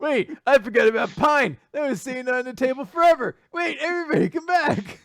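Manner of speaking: high, loud, mock-frantic shout; hammed-up plea to a departing crowd; calling out desperately. Calling departed friends back for a pun.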